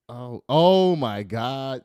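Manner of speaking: stunned oh my God; shocked exclamation. Real, not acted. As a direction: gasp it out slow in disbelief. Shocked at story.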